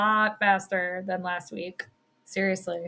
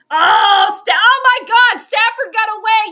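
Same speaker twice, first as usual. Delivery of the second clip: loud, high cry of disbelief; real shocked outburst; 9 semitones higher, 10 dB louder than usual. Real, not acted. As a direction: shout it high as the play escapes. Live reaction to escape on field.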